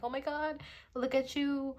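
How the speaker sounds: high-pitched, mildly gushing imitation; admiring gush. Acting out someone complimenting a stranger, admiring gush.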